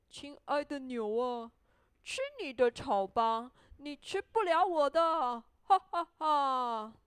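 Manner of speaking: tease, building to a loud mock laugh; taunting gingerbread man. A narrator's reading. Gingerbread man refusing the cow, playful loud taunt.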